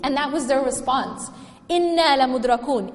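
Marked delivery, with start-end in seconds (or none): 1.7-2.9 rapid recited verse; Quran recitation. Acted. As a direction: chant it fast, like recitation.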